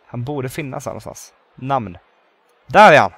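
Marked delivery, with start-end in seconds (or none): 2.7-3.1 loud, excited cry of discovery; excited shout. Real, not acted. Player spotting the awaited celebrity, excited shout. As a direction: shout it out as you spot him.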